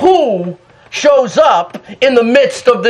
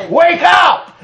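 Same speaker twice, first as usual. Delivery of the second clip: wake-up call; 6 semitones higher than usual. Real, not acted. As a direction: call it out to shake them awake. Preacher calling church awake.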